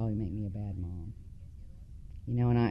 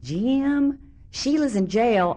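high, drawn-out holler across the house; mock stepmom hollering for her husband; calling out to husband; 10 semitones higher, 4 dB louder, much slower than usual. Stepmom calls out to dad.

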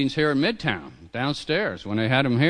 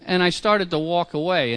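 ordinary delivery

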